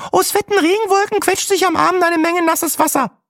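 very high, over-cheery weather-woman voice; mock a gushing TV weather girl; chirpy weather presenter. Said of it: "mit Sätzen wie".

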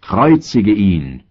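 slow, drawn-out outcry, not a full shout; narrated crowd cry. A narrator's reading. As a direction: stretch the words into a crowd's call. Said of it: "Sie schrien aber". (gedehnter, gemäßigter Aufschrei der Menge)

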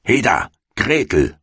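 high-pitched witch's summons; bossy, high storybook call. A narrator's reading. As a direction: call sharply to the girl as an impatient witch. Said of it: "rief sie dem Mädchen zu".

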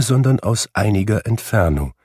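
ordinary delivery

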